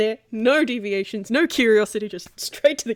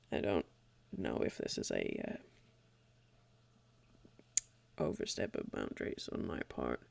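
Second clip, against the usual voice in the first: much lower and softer, almost breathed; hesitant near-whisper; 13 dB quieter than usual. Acted. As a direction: tread carefully, barely voicing it. Chifley hesitantly raises a delicate matter.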